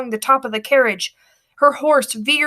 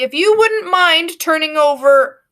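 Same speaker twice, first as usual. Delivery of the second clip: high, louder, swaggering; proud demand; 6 semitones higher, 5 dB louder than usual. A narrator's reading. Boy proudly demands the goods from the passenger. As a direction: swagger like a gallant robber. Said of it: "he says proudly".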